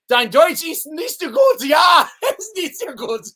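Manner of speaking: loud, high laughing German jab; hammed-up German teasing; mock German shout. Guest teasing host's German.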